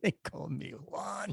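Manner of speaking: softly rolled Juan, slow and hushed; a hammed-up announcer introducing himself with a Spanish accent; slow, quiet put-on Spanish accent; mock Spanish accent. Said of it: "mock self-introduction".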